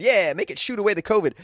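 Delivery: ordinary talk, nothing marked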